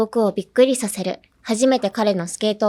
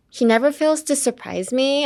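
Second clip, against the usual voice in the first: put-on Valley Girl lilt, every phrase lifting up at the end; rising uptalk; 6 semitones higher than usual. Shown as a demonstration. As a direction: let the sentence float up like a question. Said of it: "the melody when I speak tends to go up".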